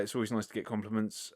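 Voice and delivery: normal talking voice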